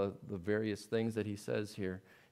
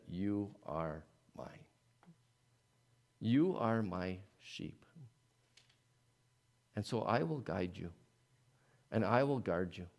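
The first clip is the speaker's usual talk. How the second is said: tender; 2 semitones lower than usual. Acted. The Lord speaks to the baptized.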